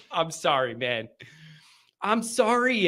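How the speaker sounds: ordinary talk, nothing marked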